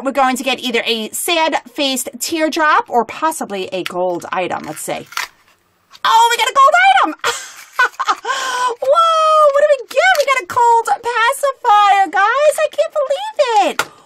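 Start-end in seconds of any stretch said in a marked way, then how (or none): 6.0-13.9 real excitement. Real, not acted. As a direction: shriek with joy at the rare prize. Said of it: "I am so excited right now".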